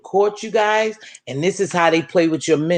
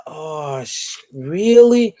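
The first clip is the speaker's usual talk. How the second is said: stunned disbelief; 3 semitones lower, much slower than usual. Retold or imitated. Stunned by the news.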